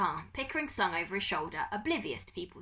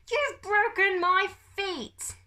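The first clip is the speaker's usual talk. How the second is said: high, loud, slow anguished wail; 13 semitones higher, 8 dB louder, much slower than usual. A narrator's reading. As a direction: wail it in horror. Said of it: "Pickering wailed".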